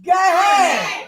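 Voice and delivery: loud, drawn-out announcer call; show title shout. Real, not acted. Host calling out show title to crowd, drawn-out shout. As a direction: belt it out slowly, high and loud.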